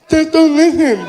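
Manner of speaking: high, loud voice of a deaf man; insistent deaf fan. Acted. Deaf fan tells comedian to toughen up, high loud insistence. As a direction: press the point loudly in deaf speech. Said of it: "he was partially deaf".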